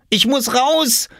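put-on high, urgent boyish cry; desperate call. A narrator's reading. Oskar locked in and needing the toilet, crying out. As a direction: plead loudly to be let out. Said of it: "rief er".

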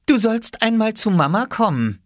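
put-on very high girl's voice; high-pitched girlish order; child tattling. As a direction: deliver the summons in a high kid voice. Girl summons brother.

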